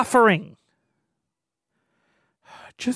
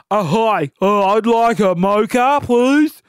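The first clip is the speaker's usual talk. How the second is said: louder, halting man's order; mock hesitant customer; 5 dB louder, slower than usual. Next customer hesitantly orders a mocha, louder. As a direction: play an awkward guy ordering.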